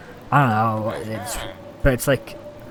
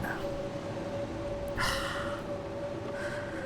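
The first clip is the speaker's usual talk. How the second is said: long, quiet sigh; 17 dB quieter than usual. Real, not acted. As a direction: let out a long deflated breath. Deflated after losing the fire.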